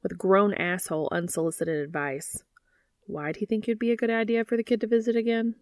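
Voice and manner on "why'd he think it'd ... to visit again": character voice. Acted. Dean voiced on phone.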